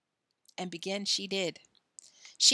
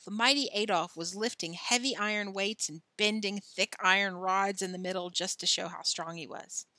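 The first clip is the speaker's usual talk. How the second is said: ordinary delivery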